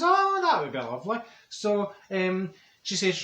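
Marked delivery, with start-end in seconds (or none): 0.0-1.2 higher, put-on polite voice; mock-genteel acceptance; posh lovely. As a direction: act the refined lady accepting. Marjorie accepts Wilma's invitation.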